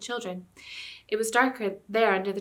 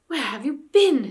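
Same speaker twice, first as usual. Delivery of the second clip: slow, louder, biting the words out; a frightened boy's angry hiss; furious whisper; 5 semitones higher, 8 dB louder, slower than usual. A narrator's reading. Eustace scolds Jill after the scare, furious whisper. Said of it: "in a furious whisper".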